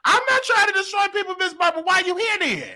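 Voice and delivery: high-pitched, indignant protest; defensive squeal of disbelief. Real, not acted.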